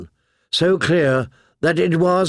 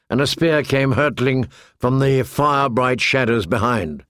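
usual speaking voice

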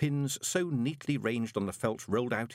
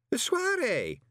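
very high, incredulous echo; high-pitched flustered man; baffled visitor; 4 dB louder, slower than usual. A narrator's reading. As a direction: repeat the strange word in bafflement. Drunk visitor repeats unfamiliar word.

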